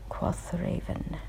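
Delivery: plain reading voice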